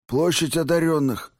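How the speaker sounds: high-pitched lisping reply; lisping injured thug. A narrator's reading. Beaten thug answers interrogator, lisping. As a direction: force it out through a hurt mouth. Smashed-mouth man answers.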